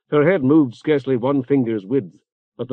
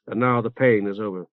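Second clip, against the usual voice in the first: plain reading voice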